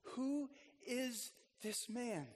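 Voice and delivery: preacher voicing the scribes' bewildered question; slow wondering. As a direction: let the question hang slowly.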